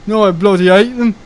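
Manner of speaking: high, loud Brummie viewer voice; mock Birmingham accent. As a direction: imitate an indignant Brummie commenter. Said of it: "gonna get a nasty comment from someone saying".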